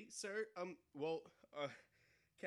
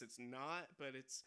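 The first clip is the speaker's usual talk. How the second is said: normal talking voice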